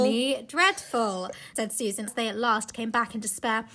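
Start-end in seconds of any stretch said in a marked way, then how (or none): 0.0-1.3 drawn out. Acted. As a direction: savour the odd words slowly. Repeated slowly, drawn out.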